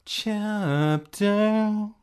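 slow booming announcer. Acted. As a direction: announce it like a trailer. Announcing the chapter.

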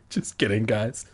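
normal talking voice

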